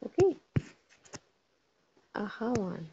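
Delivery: ordinary delivery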